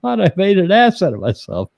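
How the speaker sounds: high flustered wife voice; imitating flustered wife. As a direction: play her mortified, pitched well up. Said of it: "She's like".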